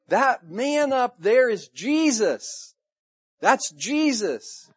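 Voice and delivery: high, loud, slow exclamation; excited recognition. Acted. John spots Jesus from the boat, excited recognition. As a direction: call it out in drawn-out amazement.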